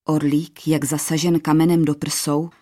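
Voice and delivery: ordinary delivery, nothing marked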